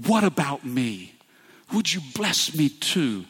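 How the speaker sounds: begging. A narrator's reading. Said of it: "he begged".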